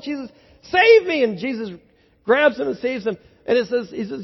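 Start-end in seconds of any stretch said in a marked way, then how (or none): 0.0-1.2 high, loud cry for rescue; acted desperate shout to be saved; calling out for help. Sinking Peter calls out to Jesus, crying for help. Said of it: "He crawls, calls out".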